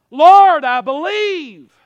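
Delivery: high, ringing call to the Lord; loud cry of faith. Acted. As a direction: call out loudly, stretching the words. Acts the father's cry of faith.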